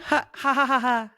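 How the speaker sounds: hammy villain cackle; a mock evil "ha ha ha"; evil laugh. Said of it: "That's my evil laugh".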